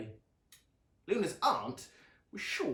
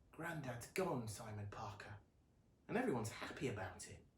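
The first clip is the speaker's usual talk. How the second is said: much softer, subdued; sad quiet girl; 10 dB quieter than usual. A narrator's reading. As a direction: Luna tells her pet rat softly, hushed and sad. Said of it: "Luna said to her rat".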